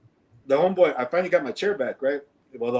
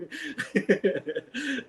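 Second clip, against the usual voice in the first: high burst of laughter; 10 semitones higher, 7 dB quieter than usual. Real, not acted. Laughing at the punchline.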